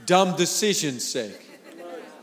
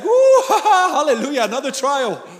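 laughing hallelujah, pitch leaping high; mock joyful laughter; 6 semitones higher, 4 dB louder than usual. Preacher mocking a believer glad of trials, laughing. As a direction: giggle through it like trials are a treat. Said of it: "It's like".

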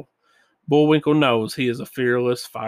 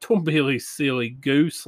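plain reading voice

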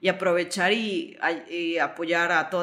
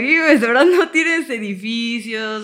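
incredulous retort; 6 semitones higher, 10 dB louder, slower than usual. Retold or imitated. Indignant laughing retort.

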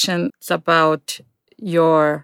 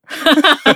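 a real laugh; laughter; 9 semitones higher, 6 dB louder than usual. Real, not acted. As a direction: laugh at the joke. Laughing at sleep joke.

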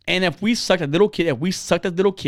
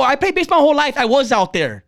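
higher-pitched retort, mildly heated; indignant comeback; 7 semitones higher than usual. Retold or imitated. Uncle to a man in the stands, indignant. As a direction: push back at a critic, imitating an argument.

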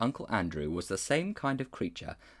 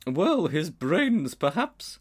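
slow, higher mild elephant; reply mildly, unhurried; 7 semitones higher, 4 dB louder, much slower than usual. A narrator's reading. She-elephant mildly answering the bulldog. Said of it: "she replied mildly".

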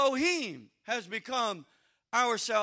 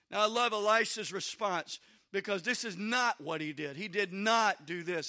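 ordinary talk, nothing marked

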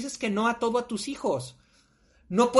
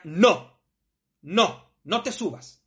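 firm dog-trainer no, commanding; slower than usual. Retold or imitated. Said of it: "le decía". (orden firme y seca al perro)

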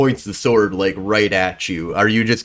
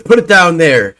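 louder, higher order; commanding NPC; 7 semitones higher, 4 dB louder than usual. Acted. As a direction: point the sword and command. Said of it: "he points the sword down ... and he goes".

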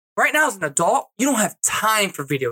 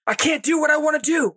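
shouted complaint, pitched up; mock-exasperated yell; frustrated outburst; 7 semitones higher than usual. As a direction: blurt it like a worn-out dad. Voicing a frustrated dad.

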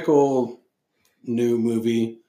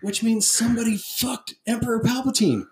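incredulous disgust; 11 semitones higher than usual. Real, not acted. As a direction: sound like you can't believe anyone would. Mocking disbelief about Palpatine.

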